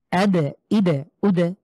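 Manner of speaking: আদে, ইদে, উদে with the দ bounced into an echo; qalqalah bounce. Shown as a demonstration. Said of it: "আমরা ধাক্কা দিয়ে".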